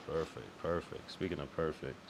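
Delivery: ordinary delivery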